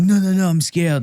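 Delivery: put-on high-pitched French accent, panicky refusal; mock French accent, pitched high; scared French reporter. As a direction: imitate a frightened French reporter. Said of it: "I got these French reporters".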